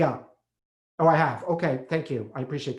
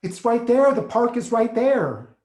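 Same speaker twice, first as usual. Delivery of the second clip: higher and louder, the second line slowed; alarmed cry about the park, much higher and louder; panicked outburst; 8 semitones higher, 7 dB louder, slower than usual. Acted. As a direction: blurt it out in rising panic.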